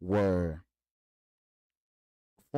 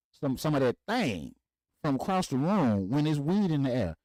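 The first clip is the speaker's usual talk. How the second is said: incredulous question; 8 semitones higher, 4 dB louder than usual. Retold or imitated. Son challenging his father about smelling her, loud and incredulous. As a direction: challenge him like you can't believe it.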